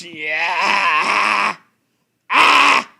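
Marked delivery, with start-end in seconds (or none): 2.3-2.9 loud high cry of disgust; disgusted yell. Real, not acted. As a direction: yelp in revulsion. Reacting to gross story.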